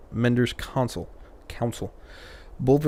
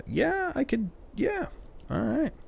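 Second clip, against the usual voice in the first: high squeak; 4 dB quieter than usual. Acted. High squeaky dithering reply.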